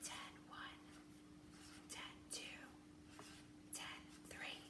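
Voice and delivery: whispered counting. Shown as a demonstration. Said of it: "whisper count as you do it".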